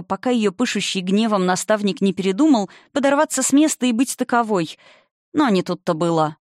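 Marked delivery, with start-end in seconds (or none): none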